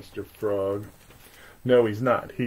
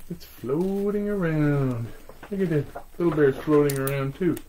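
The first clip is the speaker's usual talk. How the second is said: ordinary delivery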